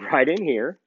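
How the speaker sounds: ordinary talk, nothing marked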